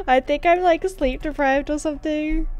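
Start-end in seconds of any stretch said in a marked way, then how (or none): none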